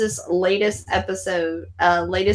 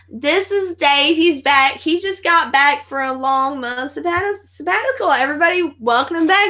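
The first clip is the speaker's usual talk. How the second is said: flight attendants; 8 semitones higher, 3 dB louder than usual. Retold or imitated. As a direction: imitate a perky crew welcoming someone back. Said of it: "and they're like".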